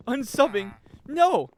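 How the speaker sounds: loud high protest; sudden high loud no; dismayed. Real, not acted. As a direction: cry out in dismay. Dismay at chat word.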